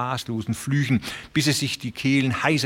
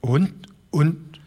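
put-on higher, halting old man's stammer; 6 semitones higher than usual. A narrator's reading. Old Broderson asking about the railroad, stammering higher. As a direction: stumble over the words in dismay. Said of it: "stammelte der alte Broderson".

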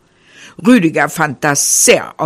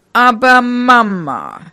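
put-on high, drawn-out childish objection; half-hearted protest; 5 semitones higher, slower than usual. A narrator's reading. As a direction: whine a slow objection to mother. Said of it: "widersprach nur halbherzig". (halbherziger Widerspruch)

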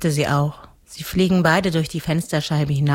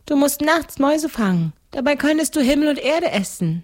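put-on higher little-girl voice; seven-year-old girl; 11 semitones higher than usual. (kindliche Mädchenstimme)

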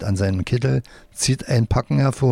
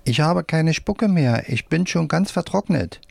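lightly voiced, higher puppet; a hint of a complaining puppet, raised pitch; rag puppet; 6 semitones higher than usual. A narrator's reading. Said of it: "der Lappen auf Wolframs Hand".

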